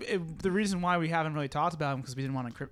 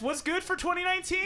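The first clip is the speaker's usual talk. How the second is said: shouted greeting; 11 semitones higher, faster than usual. Real, not acted.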